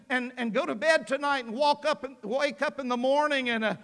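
ordinary delivery

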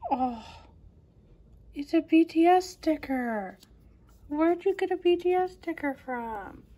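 high, excited surprise; delighted surprise at the find; surprised. Real, not acted. Surprise at [name] sticker.

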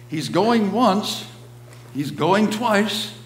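high, slow drawn-out auction call; a hint of the auctioneer, solemn. A narrator's reading. Echoing auctioneer in poem.

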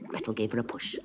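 ordinary delivery

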